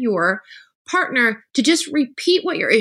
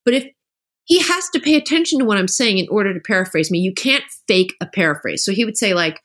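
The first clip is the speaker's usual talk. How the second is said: ordinary talk, nothing marked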